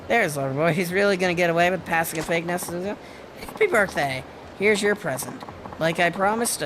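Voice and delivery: ordinary talk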